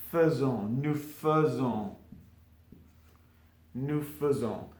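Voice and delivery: teacher sounding out "faisons" carefully; pronounced faisons. Shown as a demonstration. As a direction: model "nous faisons", slow on fuh-zohn. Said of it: "not fait, but".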